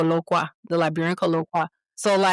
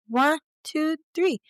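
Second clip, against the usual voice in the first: lightly voiced child counting, higher; toddler counting; 7 semitones higher, slower than usual. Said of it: "babies that ... then they go".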